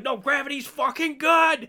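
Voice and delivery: high, loud mock protest; hammed-up interrupting shout; mock rebuttal. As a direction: shout the comeback in a skit voice. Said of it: "I'll be like".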